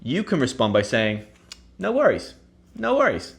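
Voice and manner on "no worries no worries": high, airy sing-song reassurance; breezy no worries. Shown as a demonstration. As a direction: brush off the thanks lightly. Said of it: "Basically meaning".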